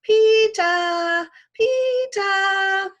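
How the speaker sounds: put-on high, loud toddler shout of Peeta; little kid yelling from the jungle gym; toddler calling. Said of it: "That's how he says my name".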